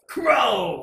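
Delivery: loud shouted character name; battle cry. Retold or imitated. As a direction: call out his name like a fighter's burst. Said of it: "especially for his burst".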